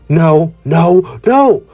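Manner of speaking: rising into high-pitched protest; outraged villain. Acted. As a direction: build from denial to high, frantic accusation. Villain outraged as his creature falls.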